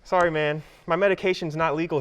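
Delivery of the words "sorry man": ordinary talk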